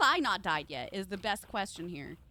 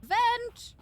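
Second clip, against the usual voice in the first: high, loud shout of vent; excited callout; 12 semitones higher, 5 dB louder than usual. Real, not acted. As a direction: call it out with a jolt. Calling out a vent.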